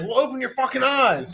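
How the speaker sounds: high, laughing angry yell; mock angry yell. Retold road rage.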